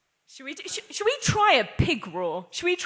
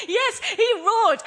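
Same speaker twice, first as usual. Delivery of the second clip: high, loud and slow, still laughing; 12 semitones higher, 6 dB louder, slower than usual. Real, not acted. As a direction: ride the laugh from the roar. Laughing after the group roar.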